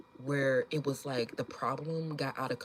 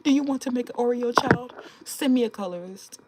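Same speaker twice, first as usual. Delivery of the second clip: mock social media pitch; 8 semitones higher, 7 dB louder than usual. Mocking how commenters should market themselves online, hammed-up pitch. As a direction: sell it like a cheesy self-promo ad. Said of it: "That's how y'all should start marketing".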